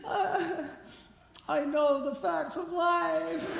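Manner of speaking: high, slow, loud wailed confession; laughing imitation of a sobbing child; mock sobbing wail. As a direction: wail it like a crying kid. Sobbing kid quote, laughing.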